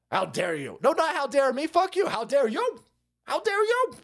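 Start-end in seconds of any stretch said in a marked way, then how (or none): 3.2-3.9 high shrill mock outrage. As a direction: squeal it in fake offense.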